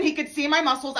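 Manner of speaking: normal talking voice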